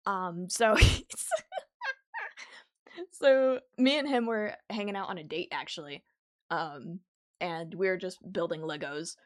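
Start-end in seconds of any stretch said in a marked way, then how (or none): none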